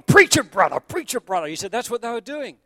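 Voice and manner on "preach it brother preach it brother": high, loud call from the pews; an excited shout of encouragement; congregation call-out. Retold or imitated. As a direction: egg the preacher on. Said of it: "they'd be going".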